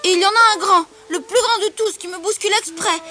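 put-on high, loud child complaining; little girl voice. Little girl denouncing the big boy to her lion, high insistent voice. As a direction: tell on the bully in a high child's voice.

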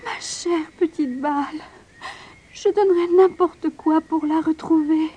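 a lightly voiced girl mourning her lost ball; tearful lament. A narrator's reading. Princess crying over her lost golden ball, lamenting. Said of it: "Elle se mit à pleurer et à se lamenter".

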